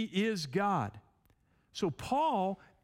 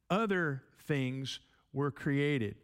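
"other" leaned on, read slowly; slow down and weigh the word "other"; stress on other; slower than usual. Shown as a demonstration. Preacher reading the inserted word, slowly weighing "other". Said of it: "five times it's the word other".

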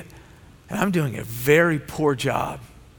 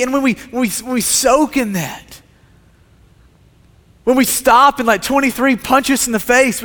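higher, louder impassioned sermon voice; preaching at full force; 7 semitones higher, 5 dB louder than usual. Acted. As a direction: drive each question home to the congregation.

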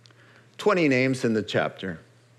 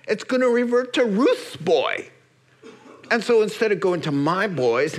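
mock whiny kinsman; 8 semitones higher than usual. Kinsman complains about losing the field.

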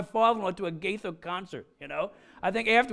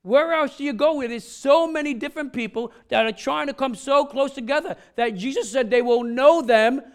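higher, louder, rising sermon fervor; building preaching; 4 semitones higher, 4 dB louder than usual. Acted. Pastor urging the congregation toward unity, higher and louder preaching. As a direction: drive the point home with mounting passion.

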